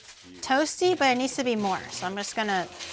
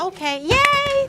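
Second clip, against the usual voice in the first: excited; 13 semitones higher, 6 dB louder, slower than usual. Real, not acted. Thanked for gifts, cheering.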